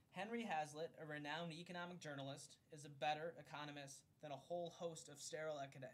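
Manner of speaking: formal book-reading voice; reading aloud. Acted. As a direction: read it like an audiobook narrator. Reads a book foreword aloud.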